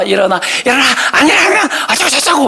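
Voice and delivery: high, rushed nagging threat; mock parental threat. Parent threatening children who won't get up, rapid mock threat. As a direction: escalate into a high-pitched mock scolding. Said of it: "막 협박 막 해요".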